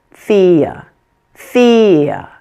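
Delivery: "thea" with long first part and weak schwa after; strong-weak syllables. Shown as a demonstration. Teacher joining the first two syllables, strong then weak. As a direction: lean on the start of "thea", let the end relax. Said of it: "short, quiet, weak syllable in the middle".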